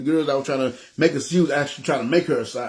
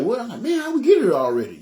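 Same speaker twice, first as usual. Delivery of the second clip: higher, puzzled snap out of a daze; startled; 9 semitones higher than usual. Retold or imitated. Zoned-out passenger realizing they have arrived, startled. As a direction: act startled, coming out of a zone-out. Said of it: "I kinda snapped out like".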